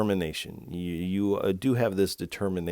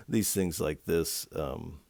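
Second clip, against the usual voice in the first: normal talking voice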